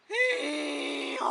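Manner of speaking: loud wailing hee-haw; donkey bray. A narrator's reading. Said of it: "Jack asked the donkey".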